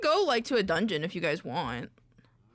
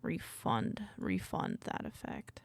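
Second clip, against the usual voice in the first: whispering; 12 semitones lower, 7 dB quieter, slower than usual. Real, not acted. Muttering menu options.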